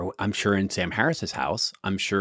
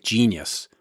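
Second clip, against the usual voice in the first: ordinary delivery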